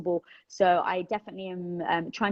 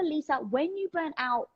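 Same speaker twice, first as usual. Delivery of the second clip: ordinary talk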